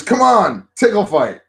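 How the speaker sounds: high, loud playful nagging; imitated eager coaxing. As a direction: keep egging them on. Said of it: "She goes".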